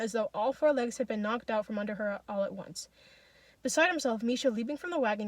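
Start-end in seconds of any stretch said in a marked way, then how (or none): none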